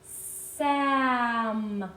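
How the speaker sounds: "Sam" drawn into sssaaammm; every sound of "Sam" held long; slowly stretched out. Shown as a demonstration. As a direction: sound it out slowly for children to join. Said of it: "Say it with me one more time".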